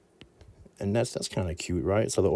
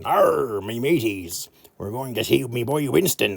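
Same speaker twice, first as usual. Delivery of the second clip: pirate voice; 3 semitones higher than usual. Acted. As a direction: play a cartoon pirate captain. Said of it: "I always think of a pirate".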